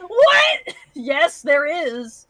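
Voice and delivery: high, loud disbelieving outburst; shocked cry of what; incredulous shout. Real, not acted. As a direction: yell it in disbelief. Outraged reading of chat.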